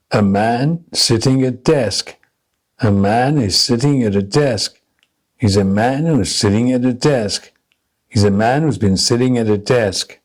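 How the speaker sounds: even beats on "man", "sitting", "desk", small words squeezed between; stress-timed rhythm drill. Shown as a demonstration. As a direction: hit "man", "sitting", "desk" at equal spacing, little words unstressed. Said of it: "compressing the function words without any stress".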